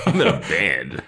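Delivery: a real laugh through a mock boast; chuckling while playing the cool guy. Real, not acted. Mocking Oz's bravado, laughing.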